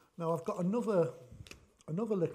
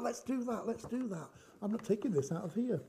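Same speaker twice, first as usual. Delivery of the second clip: ordinary delivery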